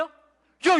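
usual speaking voice

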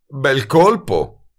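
slow, sour growl; a sarcastic growl, dragged out; ringhiando. A narrator's reading. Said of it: "ringhiò l'impiegato". (ringhio sarcastico e lento)

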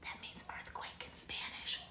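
a whisper; whispered aside. Acted. Narrator whispering the meaning to children, whisper. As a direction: hush it like a secret.

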